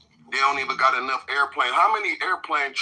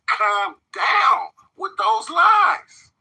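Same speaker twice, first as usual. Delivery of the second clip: mock shout; 14 semitones higher, 5 dB louder, much slower than usual. Mocking shout at rival.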